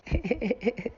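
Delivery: soft, gleeful little laugh; a quiet laugh at how it turned out; real laugh. Real, not acted. Storyteller laughing softly at his court win.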